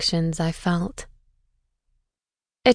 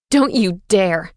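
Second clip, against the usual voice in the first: slow, hard and louder; furious warning; 5 dB louder than usual. A narrator's reading. Eden warns Violet in rage, slow and hard. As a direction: spit each word as a warning.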